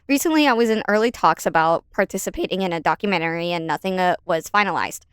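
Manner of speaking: quick, rushed reread of the line; fast reread. Shown as a demonstration. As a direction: rattle the corrected line off fast. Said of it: "Okay, whatever".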